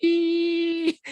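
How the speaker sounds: high mimicked monitor beep; flatline beep. Patient mimics the heart monitor going flat, high beep. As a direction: copy the flatline tone, high and held. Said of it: "the last thing I heard was".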